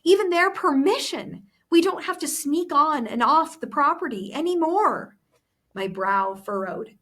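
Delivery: reading the novel aloud to the class; story narration. Acted. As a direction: tell the story with the characters' lines voiced. Teacher reads the novel aloud.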